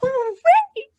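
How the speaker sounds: high loud cheer; shout. Shown as a demonstration. Kids' song cheer, happy shout. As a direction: cheer it out big. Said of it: "Give a shout".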